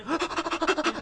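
quick, high, frantic gasps; mock panicked puffing on the run; imitated running panting. Little brother fleeing angry brother, mock frantic gasping. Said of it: "I ran".

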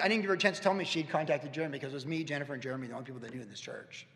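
normal talking voice

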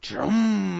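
drawn-out engine rumble; imitated engine starting. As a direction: rev it like a car catching.

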